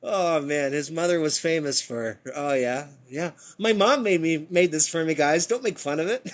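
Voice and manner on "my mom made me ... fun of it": louder, pleading schoolboy whine; mock embarrassed student. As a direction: beg classmates not to tease. Voicing teased student Tesla.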